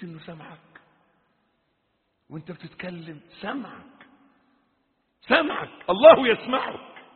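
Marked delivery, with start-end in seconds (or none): 3.4-4.1 softly said, almost to oneself; hushed reminder. Real, not acted. Same word, soft then loud. 5.2-7.0 high, raised preacher's cry; forceful warning. Real, not acted. Same word, soft then loud.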